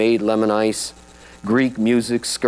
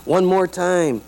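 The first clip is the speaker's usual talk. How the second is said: higher, punchy big-band call; playful retold shout of one more time; mild mock shout; 8 semitones higher, much slower than usual. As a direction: sing it out like the band's finale. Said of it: "like they shout at the end".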